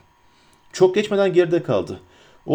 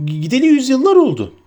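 high, loud hobbit wail; impatient outcry; 9 semitones higher, 5 dB louder than usual. A narrator's reading. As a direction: exclaim loud and high, exasperated. Pippin's alarmed shouting continues. (sabırsız, tiz feryat)

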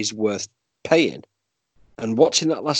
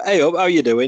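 cheery Yorkshire greeting, pitched up; lifted, louder Yorkshire hello; Yorkshire accent; 6 semitones higher, 4 dB louder than usual. Retold or imitated. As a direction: greet them in broad Yorkshire. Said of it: "with the Yorkshire accent".

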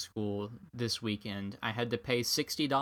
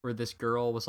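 usual speaking voice

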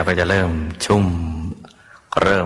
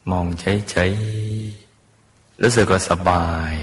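drawn out slowly; much slower than usual. Acted. Relaxed gazing, stretched words.

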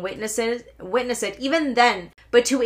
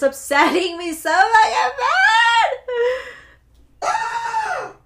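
high, loud wail of frustration; upset wail; 14 semitones higher, 6 dB louder, slower than usual. Real, not acted. Vlogger venting to viewers about being upset, frustrated wail. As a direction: stretch it up into a squealing cry.

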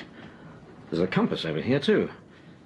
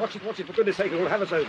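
alarmed; 7 semitones higher, faster than usual. Acted. Boat about to capsize.

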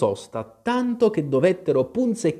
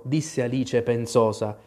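ordinary delivery, nothing marked

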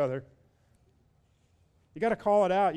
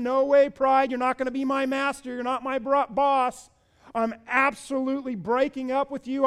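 loud, high, triumphant defiance of pride; defiant preaching at full force; 8 semitones higher, 8 dB louder than usual. Acted. Preacher voicing a believer telling pride off, loud and defiant. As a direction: shout it down like you mean it.

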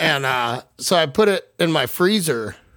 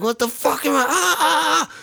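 much higher, strained, panicked wail; freaking out; 11 semitones higher than usual. Retold or imitated. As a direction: lose it on a tiny packed stage. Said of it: "just like".

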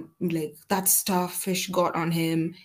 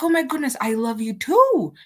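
high, louder gushing reply; overjoyed; 7 semitones higher, 4 dB louder than usual. Retold or imitated. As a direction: burst with delight. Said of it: "I was like".

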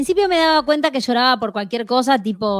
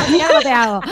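laughing; 6 semitones higher, faster than usual. Real, not acted. Laughing at age joke.

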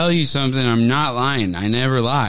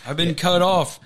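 normal talking voice

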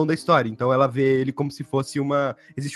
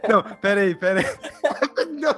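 laughing; 5 semitones higher than usual. Real, not acted. Laughing at the nickname.

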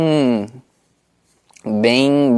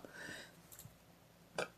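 "ó" pushed out as a burp; talking while burping; 34 dB quieter than usual. Real, not acted. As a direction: burp out the word "ó". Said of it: "calma aí que eu vou arrotar".

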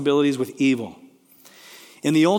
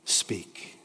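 a breathless whisper, lower and much softer; whispered plea; 7 semitones lower, 10 dB quieter than usual. Pretend. Believer begging God to speak, whispered. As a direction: let the word fade to a whisper of awe. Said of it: "Will we not just say".

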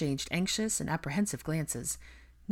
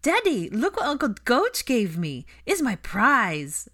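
put-on high, loud child voice, stretching the end; excited little boy; 8 semitones higher, 8 dB louder, slower than usual. A narrator's reading. A small boy shows his father a gift, excitedly. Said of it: "Aurelian said".

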